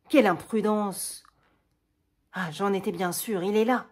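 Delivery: loud, anxious exclamation; alarmed outburst. Acted. Le Bret rushing in, alarmed and loud. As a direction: burst in scolding.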